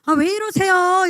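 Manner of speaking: high, loud tearful cry of protest; reliving the sobbing plea on the rooftop. Retold or imitated. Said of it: "그래서 내가 막 울었어요".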